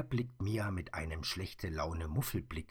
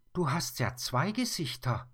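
put-on much higher, louder astonished girl; amazed; 9 semitones higher, 5 dB louder than usual. Mia discovers the bear's two faces, amazed. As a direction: gasp out the discovery. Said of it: "staunt sie".

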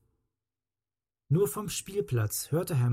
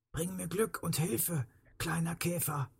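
soft, half-whispered, raised pitch; whispered plea; 5 semitones higher, 7 dB quieter than usual. A narrator's reading. Hurt man begging the little beetle for help, whispered. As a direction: plead gently under the breath.

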